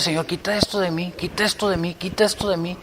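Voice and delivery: hushed, repeated plea to God; pleading prayer. Retold or imitated. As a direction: pray it softly, over and over. Acts out his own prayer.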